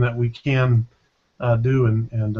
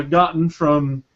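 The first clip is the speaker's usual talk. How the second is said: usual speaking voice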